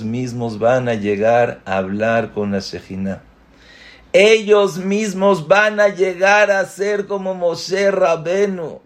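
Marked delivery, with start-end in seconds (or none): 4.1-8.8 much higher, building with wonder; excited emphasis. Shown as a demonstration. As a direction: lift "Ellos mismos" up high and marvel at it. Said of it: "repeated with emphasis". (con asombro y entusiasmo, mucho más agudo)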